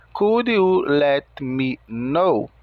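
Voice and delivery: slow, word-by-word English; paused, deliberate, carefully separated words; slow and clear. Shown as a demonstration. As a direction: spell out each word unhurriedly for a learner. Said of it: "lentamente e com palavras ditas mais claramente".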